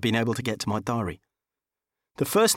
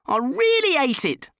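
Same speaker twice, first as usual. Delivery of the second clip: higher-pitched exasperated outburst; 12 semitones higher than usual. A narrator's reading. Driver curses his useless old banger, exasperated. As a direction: vent in a higher voice.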